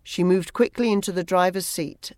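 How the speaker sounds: plain reading voice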